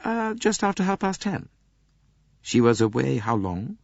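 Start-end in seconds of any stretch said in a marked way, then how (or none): 0.0-1.5 put-on composed young woman, voice lifted higher; a lightly voiced young Englishwoman; young lady's voice. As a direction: answer coolly and politely. Young lady answers Poirot.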